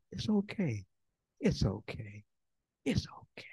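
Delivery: put-on calm a crying baby down; soothing hush.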